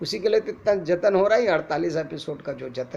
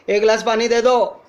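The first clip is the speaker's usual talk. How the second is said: loud, higher-pitched call without emotion; raised voice, neutral; 5 semitones higher, 9 dB louder than usual. Shown as a demonstration. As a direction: call out to someone at a distance. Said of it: "simply हम ऊंचे scale में बोलते हैं". (ऊंचे scale में, भावहीन पुकार)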